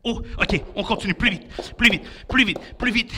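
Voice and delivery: normal talking voice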